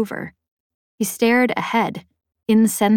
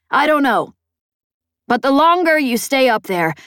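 Peg warning; 4 semitones higher, 3 dB louder than usual. Acted. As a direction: drive home a dire warning as Peg. Peg's character voice in narration.

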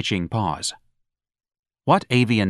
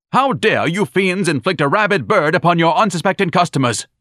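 indignant tirade; 8 semitones higher, 4 dB louder than usual. Acted. Ignatius denouncing the club.

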